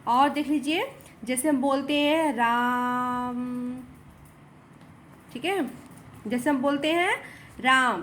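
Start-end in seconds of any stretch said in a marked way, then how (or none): 2.3-3.8 राम with the long aa held for three beats; prolonged ram. Shown as a demonstration. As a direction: slowly stretch out राम. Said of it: "दीर्घ स्वर से भी अधिक समय हम यहाँ पे ले रहे हैं बोलने में".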